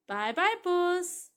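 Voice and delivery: high, loud, long-held goodbye; stretched sing-song parrot taunt; parrot's farewell. Acted. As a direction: sing out the bye-bye as you leave. Parrot's farewell acted again.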